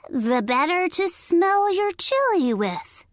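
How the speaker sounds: high fake granny voice; high-pitched granny imitation. A narrator's reading. Coyote eager for Little Red's chili, fake granny voice. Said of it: "in a high-pitched voice".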